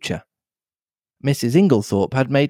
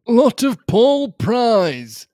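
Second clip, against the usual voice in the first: plain reading voice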